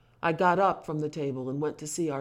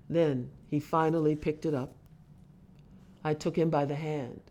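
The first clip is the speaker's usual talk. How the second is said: usual speaking voice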